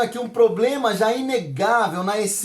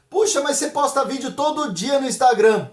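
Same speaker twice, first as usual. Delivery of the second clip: higher-pitched surprised challenge; incredulous questioner; 4 semitones higher than usual. Acted. Someone challenges the pastor about daily Instagram videos, incredulous questioner. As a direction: question them in mock amazement, pitched up.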